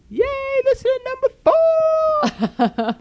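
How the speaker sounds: high, slow mock-excited cheer. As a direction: cheer it like a game show winner. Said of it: "And you go".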